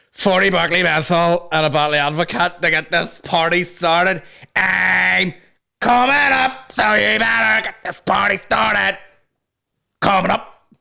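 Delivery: rally the party with a shouted order; hammed-up party shout. Party guest yelling the order down the phone, hammed up.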